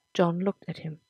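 ordinary delivery

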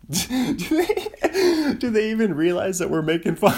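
high, loud, laughing through the question. Real, not acted. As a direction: crack up while asking. Cracks up at own bit.

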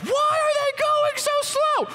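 high, slow and puzzled, at a slow truck; annoyed driver. Retold or imitated. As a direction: squeak it out like an impatient driver stuck behind you. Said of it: "you're like".

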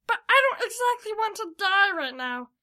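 high, loud character voice; panicky scream. A narrator's reading. Kenzo fleeing to save himself, high loud cry. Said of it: "screamed Kenzo".

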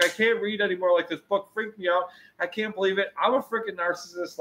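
high, whiny imitation of a meltdown; mock crying. Man retelling his meltdown to his best friend, mock crying. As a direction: replay a tearful freak-out, pitched high. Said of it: "I'm just crying".

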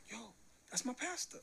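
high, surprised yo; suddenly spotting your own pastor; delighted, high-pitched exclamation; excited recognition. Retold or imitated.

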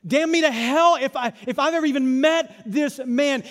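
loudly protest, mildly acted; Peter's denial. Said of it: "hearing his own words echo".

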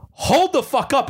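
high-pitched mock outburst; indignant retort. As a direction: cut them off, acting out indignation. Retold angry retort to customer.

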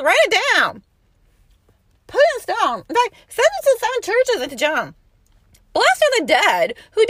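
loud, high impassioned preaching; fiery preacher's proclamation; preaching at full force. Acted. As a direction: cry it out to the church.

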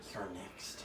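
low, very quiet threat; menacing threat. Acted. Player turns on the other two soldiers, threatening quietly. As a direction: intimidate them in a low, hushed voice. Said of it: "turn to the other two and be like".

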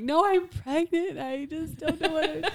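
laughing through a mock cry; tearful protest. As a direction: let the voice crack up high with tears. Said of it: "I'm like".

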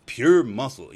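loud, mocking imitation of the brother bragging; mock brother's boast. Telling viewers about his brother's lie, loud mocking imitation. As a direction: throw his brag back at him, hammed up. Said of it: "he's like".

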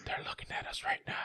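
hushed, voiceless, rushed whisper. Retold or imitated. Cartoon monster whispering about being watched, hushed whisper. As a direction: breathe it like a spooked creature.

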